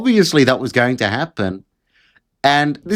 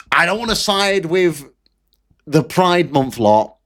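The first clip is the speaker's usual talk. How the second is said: ordinary talk, nothing marked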